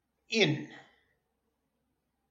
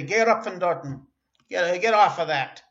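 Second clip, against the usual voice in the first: put-on calling to kids; 2 semitones higher, 8 dB louder than usual. Yelling at kids up somewhere.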